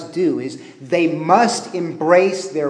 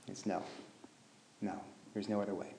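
very soft, grave; hushed answer; 9 semitones lower, 24 dB quieter, faster than usual. Retold or imitated. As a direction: drop almost to a whisper.

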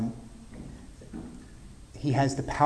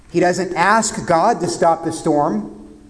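usual speaking voice

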